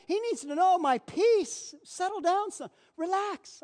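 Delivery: a father overjoyed, bidding the feast; joyful father. Acted. Father voiced reassuring the son.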